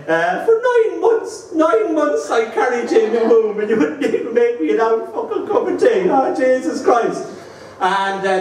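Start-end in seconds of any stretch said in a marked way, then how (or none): 0.5-7.2 mother's guilt trip. Retold or imitated. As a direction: lay on the martyred mother's guilt, hammed up. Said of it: "she'd put the guilt trip on me".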